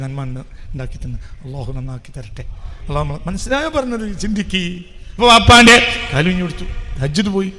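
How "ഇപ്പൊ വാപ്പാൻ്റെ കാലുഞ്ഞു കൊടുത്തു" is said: loud, pressing declaration; loud preacher's declaration; forceful preaching. Acted. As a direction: push it out to the crowd. Same phrase loud then whispered.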